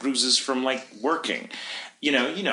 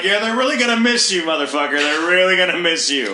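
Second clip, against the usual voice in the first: higher and louder, jeering; yelling sarcasm at the man leaving the store; mocking yell; 6 semitones higher, 4 dB louder than usual. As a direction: jeer after him as he's thrown out. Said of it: "I'm screaming".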